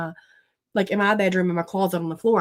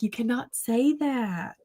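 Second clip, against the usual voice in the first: retold scolding; 3 semitones higher, 5 dB quieter, slower than usual. Retold scolding of laughing son.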